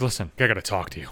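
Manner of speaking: low, hushed, grave quote; softly, low and serious, retelling; mock serious. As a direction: imitate someone gravely asking for a word. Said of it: "It's, like, all fucking serious".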